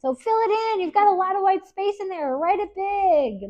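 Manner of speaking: teacher cheering students to write love big; eager urging. Real, not acted. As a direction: urge them to write it big. Enthusiastic instructions to students.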